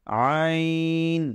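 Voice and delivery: "আইন" drawn out slowly from inside the throat; from deep in the throat. Shown as a demonstration. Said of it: "কণ্ঠনালীর ভিতর থেকে আসছে কিনা".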